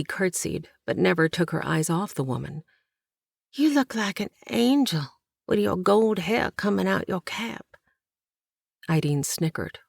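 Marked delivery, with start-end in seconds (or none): 3.5-7.8 put-on higher, wide-eyed young girl; a country girl, gently awed; girl's awed voice. A narrator's reading. Young Essie admiring Ideen's hair, higher and wondering.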